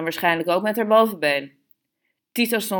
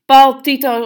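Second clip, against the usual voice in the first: loud, high scolding call; calling out sharply; 8 semitones higher, 12 dB louder than usual. A narrator's reading. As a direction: call both names out in exasperation. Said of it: "riep ze". (luid en bestraffend roepend)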